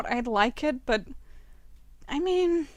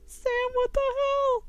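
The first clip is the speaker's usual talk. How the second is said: much higher, shocked scolding; shocked high exclaim; 11 semitones higher than usual. Real, not acted. Gamer scolding her character, shocked.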